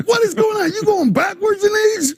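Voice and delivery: very high-pitched comic incredulity; mock astonishment. Host marvelling that the guest never ages, mock astonishment. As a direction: demand an answer, playfully baffled.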